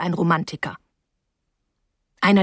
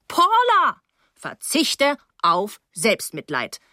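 slow, firm mock scolding; a mild stern rebuke, stress on verzichte; 3 semitones higher, much slower than usual. Paula scolding the girl, slow and firm. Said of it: "wetterte sie".

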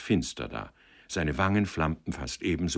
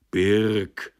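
a touch of irritation, louder; annoyed call; 3 semitones higher, 6 dB louder than usual. A narrator's reading. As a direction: snap his name in mild annoyance. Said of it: "rief Stickler ärgerlich".

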